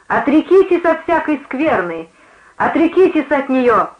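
high, impassioned exhortation; entranced preacher. A narrator's reading. Preacher swaying as in a trance, impassioned. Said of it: "стала раскачиваться, как в трансе".

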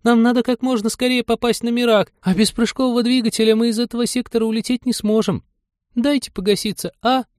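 put-on high, insistent girlish appeal; pleading young woman. A narrator's reading. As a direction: plead your case to a stubborn old man. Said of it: "взмолилась Полина".

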